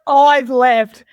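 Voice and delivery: loud mock parade-ground order; drill command. Mimics snapping to drill command.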